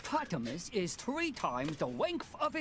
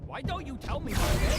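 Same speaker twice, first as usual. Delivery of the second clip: angry demand; 6 semitones higher, 8 dB louder, faster than usual. Acted. Demanding name, loud.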